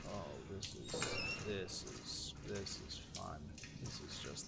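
low hushed unease. Real, not acted. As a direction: mutter it uneasily. Creeped whisper through dark area.

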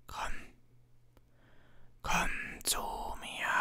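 slow, drawn-out menacing whisper; a bodiless voice hungry to kill; icy hateful whisper. A narrator's reading. The hidden voice whispers murder to Harry, slow and icy. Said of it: "erfüllt von eiskaltem Hass".

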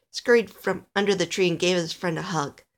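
ordinary delivery

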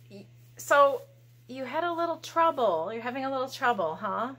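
ordinary talk

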